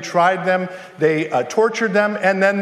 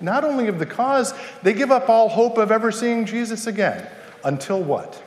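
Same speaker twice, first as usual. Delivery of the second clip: ordinary talk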